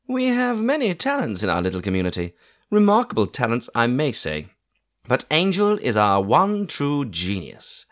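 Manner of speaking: fond aunt introducing the resident artist; proud aunt. A narrator's reading. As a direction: boast about the house genius. Aunt praises Angel.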